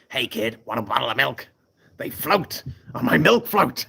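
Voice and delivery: put-on creepy clown luring a kid; put-on clown voice, climbing on the last phrase; Pennywise. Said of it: "Pennywise impression".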